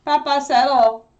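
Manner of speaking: loud, high command; firm call to the dog. Real, not acted. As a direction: tell the dog firmly to settle down. Calling the dog to settle.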